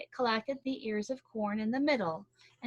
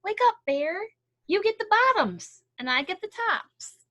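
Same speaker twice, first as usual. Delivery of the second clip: high, loud wake-up call; 11 semitones higher, 8 dB louder than usual. Acted. Hare calling Bear awake for harvest, loud and high. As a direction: call him awake across the field. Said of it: "Hare called out".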